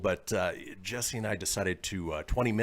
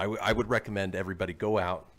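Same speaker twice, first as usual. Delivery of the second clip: ordinary delivery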